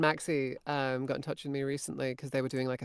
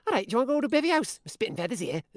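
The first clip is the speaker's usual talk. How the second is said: quick, raised Scouse voice; Liverpool accent; 9 semitones higher, 5 dB louder, faster than usual. Retold or imitated. Game line puzzling Spanish translators, Liverpool accent. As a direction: rattle it off in Liverpool dialect. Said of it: "Liverpool or something".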